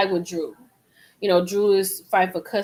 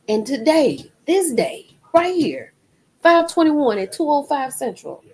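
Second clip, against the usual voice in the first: emphatic; 6 semitones higher, 4 dB louder than usual. Real, not acted. Emotional emphasis on date.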